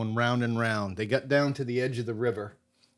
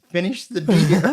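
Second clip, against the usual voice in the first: incredulous echo; 6 semitones higher, 8 dB louder than usual. Retold or imitated. Mocking partner's demand, hurt ankle.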